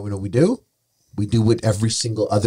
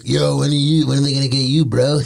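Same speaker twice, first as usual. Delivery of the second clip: louder, higher mock ribbing; teasing buddies; 5 semitones higher, 6 dB louder than usual. Normie buddies teasing him about cancellation, loud mock ribbing. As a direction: rib him like a pushy buddy. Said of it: "They're like".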